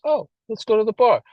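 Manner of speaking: high, bright-voiced oh, let's go to the bar; tempted drinker. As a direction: sound casually tempted. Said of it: "You're like".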